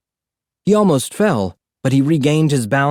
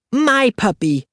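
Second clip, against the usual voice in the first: put-on high toddler voice hugging his pet; possessive toddler; 11 semitones higher than usual. A narrator's reading. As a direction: clutch it like a toddler's treasure. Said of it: "the kid said".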